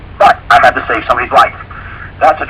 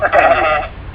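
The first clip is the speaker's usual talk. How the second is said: imitated sheep's baa; sheep bleat; 4 semitones higher than usual. Shown as a demonstration. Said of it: "That's the sound the sheep make".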